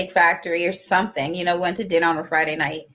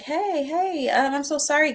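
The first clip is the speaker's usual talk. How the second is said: high, casual hey-hey, sheepish sorry; breezy apologetic boyfriend; 8 semitones higher than usual. Retold or imitated. Date pulls up and breezily apologizes, high and casual. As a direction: play it off like nothing happened. Boyfriend returns like nothing happened.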